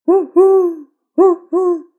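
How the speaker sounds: high, loud hooting owl cry; owl hoots. A narrator's reading. Owl swooping over the rabbits, eerie hoot. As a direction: hoot like a spooky night owl. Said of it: "poussant des cris bizarres".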